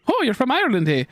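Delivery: high, hammed-up Irish accent; mock Irish exclamation; jokey Irish accent. Shown as a demonstration.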